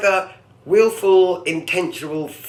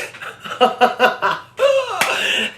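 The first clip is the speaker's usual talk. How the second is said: real laugh; 12 semitones higher than usual. Real, not acted. Laughs at a newspaper headline.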